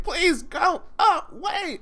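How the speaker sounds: high, slow pleading whine; whiny plea. Retold or imitated. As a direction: beg them to leave, high and drawn out. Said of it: "Just like".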